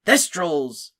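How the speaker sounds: name them like prized animals; proud. A narrator's reading. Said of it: "said Hagrid proudly".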